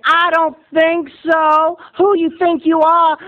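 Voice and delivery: high, slow mocking taunt; a hint of a scornful kid; taunting dare. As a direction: sneer the dare like a schoolyard bully. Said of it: "who said".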